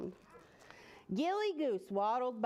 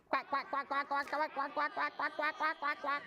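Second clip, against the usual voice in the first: rapid run of quacks; duck quacks. Shown as a demonstration. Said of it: "Can we all quack".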